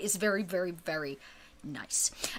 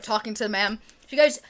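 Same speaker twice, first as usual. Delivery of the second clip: ordinary talk, nothing marked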